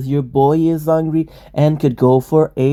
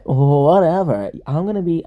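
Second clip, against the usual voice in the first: usual speaking voice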